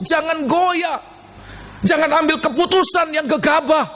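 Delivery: very high, sustained sermon exhortation; preaching. Real, not acted. Preacher exhorting a troubled congregation, high sustained preaching. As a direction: ring out the commands on a high pitch.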